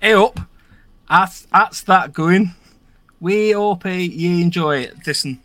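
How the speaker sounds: put-on Yorkshire accent, a touch slow and broad; greeting listeners in a hammed-up Yorkshire accent; northern accent. Podcast host greeting listeners, mock northern accent. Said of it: "you're meant to read it in a northern accent".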